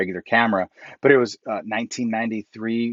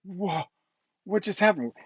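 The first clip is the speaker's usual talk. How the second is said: amazed; 8 semitones higher, 4 dB quieter than usual. Retold or imitated. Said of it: "He was like".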